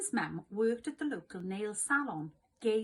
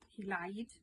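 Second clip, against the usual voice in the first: plain reading voice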